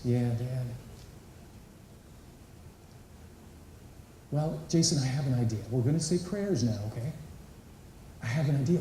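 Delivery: put-on low, soft and gentle, calling the boy close; tender dad. Father gently calling his crying son onto his lap. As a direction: coax him onto your lap softly. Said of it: "I said".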